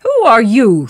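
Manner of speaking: loud, sharp challenge; forceful, indignant question; demanding. A narrator's reading. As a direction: demand the stranger's name. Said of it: "Thor demanded".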